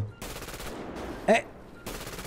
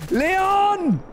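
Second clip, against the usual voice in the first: high shout; 12 semitones higher, 3 dB louder than usual. Real, not acted. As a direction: yell the name high and loud. Calling out mid-race. (lauter, hoher Ruf)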